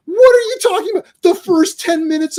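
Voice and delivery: can't believe what he's hearing; exasperated. Real, not acted. Ranting to co-hosts about a film with no Snake Eyes, exasperated.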